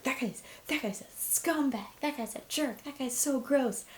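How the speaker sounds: high, indignant name-calling, not shouted; mock angry tweeting. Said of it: "angry tweeted about it".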